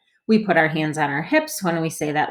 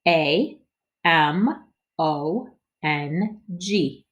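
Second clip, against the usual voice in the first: letters spaced out slowly; slow letter spelling; much slower than usual. Shown as a demonstration. Teacher leads body spelling for kids. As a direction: spell each letter deliberately. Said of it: "spell 'em with our body and with our voice".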